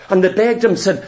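ordinary talk

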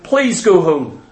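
mild, ineffectual pleading; meek pleading. Acted. Preacher mimics someone begging a barking dog to leave, meek pleading. Acts out polite plea to dog.

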